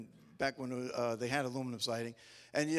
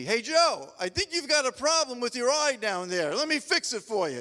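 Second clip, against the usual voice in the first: higher, much louder call from the ladder; yelling down; 8 semitones higher, 8 dB louder than usual. Retold or imitated. Imagined brother yelling down from the ladder, loud and raised. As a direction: holler it down from up high. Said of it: "yelling down to me".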